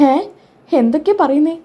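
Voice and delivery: a high, disbelieving question; as if you can't believe your ears; incredulous high question; baffled surprise. Acted. Sachi baffled by police car story.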